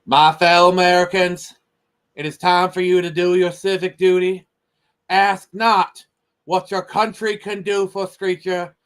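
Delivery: hammed-up Kennedy accent, orating, breaking into laughs; JFK impression. Reading the JFK speech to a stream buddy in a hammed-up Kennedy accent. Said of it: "in my best J F K accent".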